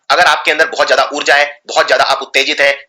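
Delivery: usual speaking voice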